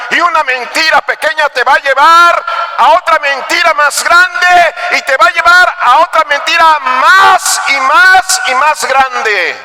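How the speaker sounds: high, insistent preaching; prédica enérgica. Real, not acted. Predica con fuerza creciente. (predicar con voz alta y enfática)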